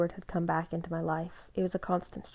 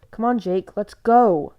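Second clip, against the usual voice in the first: loud, higher, pulling urgency; urgent shout; 4 semitones higher, 12 dB louder, slower than usual. A narrator's reading. Seth drags Jacob away, shouting urgently. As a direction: haul him away with your voice.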